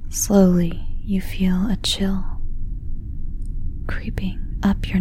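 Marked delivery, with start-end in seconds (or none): none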